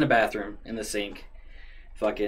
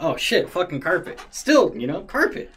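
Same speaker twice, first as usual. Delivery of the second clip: higher, louder mock panic over the carpet; alarmed about the carpet; 8 semitones higher, 6 dB louder than usual. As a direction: blurt it out, half-joking alarm. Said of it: "I'm like".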